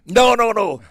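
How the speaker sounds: real playful refusal; emphatic refusal. Real, not acted. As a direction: wave the idea off firmly. Refusing to write another book.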